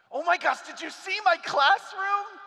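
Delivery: high, loud, slowed excited shout; thrilled new teacher. Acted. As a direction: squeal it like a giddy first-year teacher.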